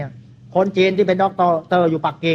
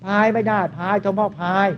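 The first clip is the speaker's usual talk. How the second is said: mock lazy rower; 2 semitones higher than usual. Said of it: "พายไปได้ยังไง".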